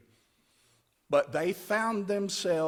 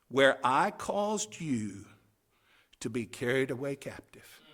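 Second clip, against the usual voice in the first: solemn scripture reading; 5 dB louder than usual. Acted. Reads scripture as God speaking.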